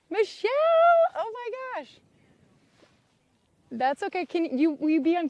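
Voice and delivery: high, loud call across the lot. Real, not acted. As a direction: shout her name from afar. Calling out to a friend.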